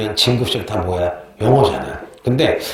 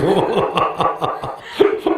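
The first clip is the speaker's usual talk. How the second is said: laugh; 5 semitones higher than usual. Real, not acted. Laughing about student photo.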